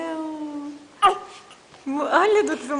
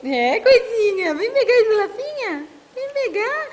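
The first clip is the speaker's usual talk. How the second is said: excited coaxing; 5 semitones higher, 5 dB louder than usual. Real, not acted. Coaxing baby to crawl.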